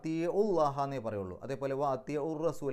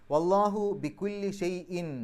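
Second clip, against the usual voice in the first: loud, slow, higher chant; Quranic recitation; 5 semitones higher, 6 dB louder, slower than usual. Shown as a demonstration. Teacher recites the verse aloud to the class. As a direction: recite with full voice, stretching each word. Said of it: "recites verse for class".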